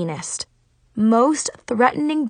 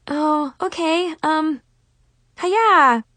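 nervous; 7 semitones higher, slower than usual. Acted. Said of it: "I threw a weak jab and smiled nervously".

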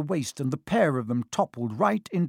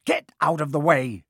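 snapping; 6 dB louder than usual. A narrator's reading. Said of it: "snapped Ron".

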